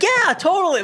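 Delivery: high, breezy mock yeah totally; imitating a careless friend, high and light; breezy mock agreement. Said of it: "And she's like".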